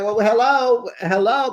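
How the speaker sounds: a loud, high mock greeting, full of sarcasm; sarcastic hello. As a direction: greet them back with a put-out "well, hello". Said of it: "you feel like".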